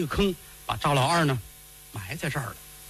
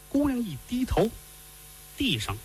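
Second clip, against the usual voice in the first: plain reading voice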